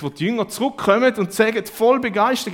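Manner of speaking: high and loud, thrilled; excited preaching. Acted. Preacher telling of disciples returning, excited and loud.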